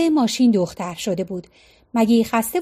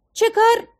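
high, startled shouted question; alarmed cry; 10 semitones higher than usual. A narrator's reading. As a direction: cry out in alarm, pitch jumping up. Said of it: "آیرا فریاد زد".

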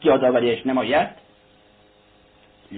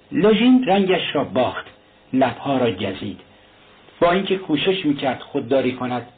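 plain reading voice

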